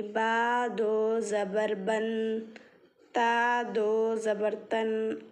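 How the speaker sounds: बा दो जबर बन and ता दो जबर तन chanted very slowly, letter by letter; slow spelling out. Shown as a demonstration. Teacher recalling the tanween lesson for students, slow recital. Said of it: "तनवीन के इसमें समझाई थी".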